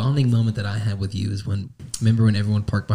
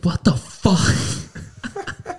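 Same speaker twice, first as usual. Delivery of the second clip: imitating angry woman; 10 semitones higher, much slower than usual. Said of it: "pops out the back window and goes".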